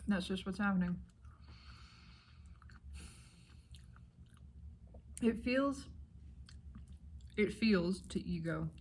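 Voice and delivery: talking with mouth full; words round a bite of breakfast. Real, not acted. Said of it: "I'm gonna talk with my mouth full".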